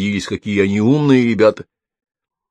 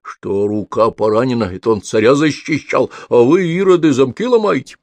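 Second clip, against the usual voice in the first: plain reading voice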